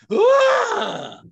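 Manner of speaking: high, loud movie scream; imitated scream. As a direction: mimic her screaming at full pitch. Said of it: "She's like".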